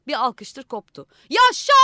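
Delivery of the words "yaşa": high, loud cheer of Yaşa; cheering shout. Acted. The crowd cheers the new mayor with a loud shout.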